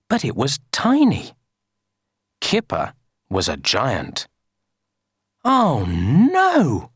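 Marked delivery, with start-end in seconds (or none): 5.4-6.9 high, startled "oh no"; storybook dismay, pitched up. A narrator's reading. Kipper finding he is a giant, dismayed.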